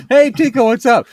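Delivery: high, loud friendly greeting; talking to a dog. Acted. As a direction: call out cheerily to the dog.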